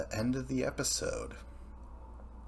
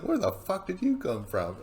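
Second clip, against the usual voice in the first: higher, startled cry of disbelief; shocked outburst; 10 semitones higher than usual. Retold or imitated. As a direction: blurt it out stunned. Rival shocked being passed.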